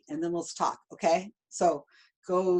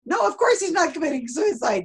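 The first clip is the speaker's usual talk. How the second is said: dismissive; 9 semitones higher, 4 dB louder than usual. Retold or imitated. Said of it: "we're like".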